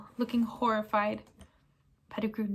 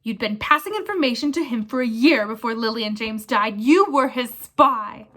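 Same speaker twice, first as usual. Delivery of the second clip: furious accusation; 7 dB louder than usual. Acted. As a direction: hammer the accusation. Sirius accuses Pettigrew furiously.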